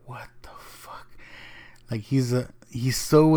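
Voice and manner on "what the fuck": stunned whisper. Retold or imitated. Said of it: "He looks at Amy Schumer like".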